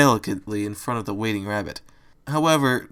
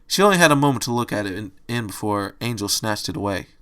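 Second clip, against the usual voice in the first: usual speaking voice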